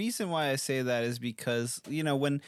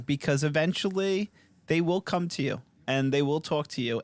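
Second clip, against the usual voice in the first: ordinary delivery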